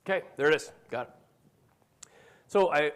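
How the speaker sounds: usual speaking voice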